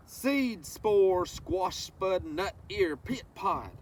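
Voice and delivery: slow, lilting read-aloud of a rhyming book; reading to children. Acted. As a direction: savor each word for the little ones. Reads picture book to children.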